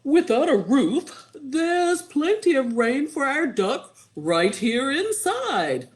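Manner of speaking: a hint of a triumphant wizard; lightly voiced wizard. A narrator's reading. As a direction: announce the magic trick, rising to the end. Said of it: "said the wizard".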